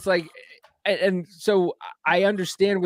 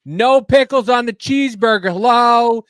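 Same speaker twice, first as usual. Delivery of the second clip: higher and louder, fed up with the bot; frustrated customer yelling at a drive-thru speaker; exasperated yell; 7 semitones higher, 8 dB louder than usual. Retold or imitated. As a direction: shout the order at a machine that won't listen.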